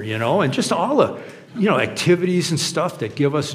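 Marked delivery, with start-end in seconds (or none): none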